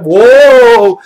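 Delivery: huge, high, drawn-out "whoa"; mock-alarmed long cry; pilot's startled whoa. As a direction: holler it as the plane drops. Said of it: "he literally went".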